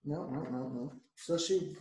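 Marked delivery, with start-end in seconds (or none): none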